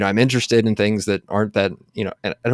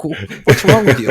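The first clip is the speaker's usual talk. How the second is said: louder, higher, pushy retort; mock-brash ladies scolding a tall newcomer; mock in-your-face challenge; 5 semitones higher, 6 dB louder than usual. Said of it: "ladies will be like".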